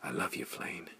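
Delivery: soft whisper, close and tender. Acted. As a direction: breathe it softly to him. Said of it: "She whispered".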